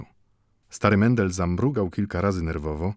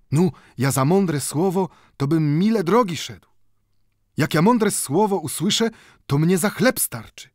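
Mendel, higher and louder, eager; 8 semitones higher, 4 dB louder than usual. A narrator's reading. Mendel eagerly answers the watchmaker, higher. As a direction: praise the wise word eagerly.

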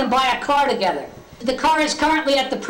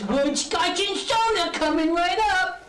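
same hairlip voice; 5 semitones higher, 3 dB quieter, slower than usual. Retold or imitated. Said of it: "This is the way I talk".

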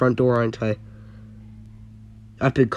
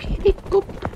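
helicopter meme voice. Retold or imitated. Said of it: "that meme where it goes like".

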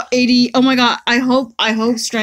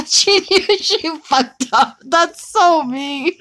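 laughing; 6 semitones higher, much slower than usual. Real, not acted. Laughing at partner's gacha joke.

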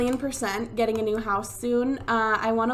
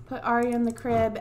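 ordinary talk

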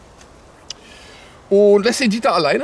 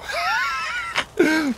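high shrieking burst of laughter; 5 dB quieter than usual. Real, not acted. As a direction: let out a wild squealing laugh.

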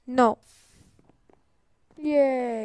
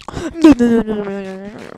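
panicked no; 2 semitones lower, 9 dB louder than usual. Real, not acted. Panic at the egg.

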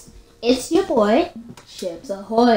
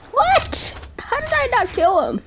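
high-pitched shocked squawk; incredulous outburst; 12 semitones higher than usual. Real, not acted. Player whose opponent survived, high incredulous outburst. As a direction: start very high in sheer disbelief.

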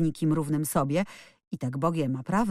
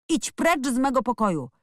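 high-pitched, moderately loud order; narrated outraged cry; angry shout; 8 semitones higher, 3 dB louder than usual. A narrator's reading. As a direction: order them out in acted fury. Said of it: "krzyknął".

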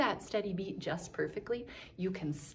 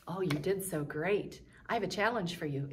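normal talking voice

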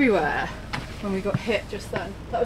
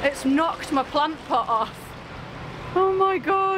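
high, drawn-out real shock; aghast at the damage, pitch leaping up; shocked dismay; 8 semitones higher, slower than usual. Real, not acted. Seeing the plant pot knocked off, shocked dismay. Reacting to boat being hit.

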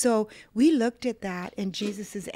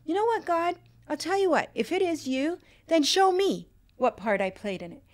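higher, challenging demand at God; retold anger; 8 semitones higher, 5 dB louder than usual. Angry wife challenging God in the bathroom, pitched-up defiant demand. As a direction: throw the dare up at God, pitched high. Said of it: "anger came up again".

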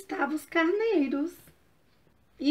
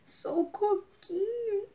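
put-on tearful child's whimper, higher and slow; crying; 7 semitones higher, 3 dB quieter, slower than usual. Coquinho answers the fox through tears, crying. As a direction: sob out the name like a lost little child.

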